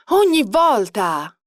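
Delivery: slow, emphatic exasperation; exasperated outburst. A narrator's reading. Friend fed up with Travis's conquests, exasperated outburst. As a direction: burst out, stretching both words. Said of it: "sbottò America fissandola".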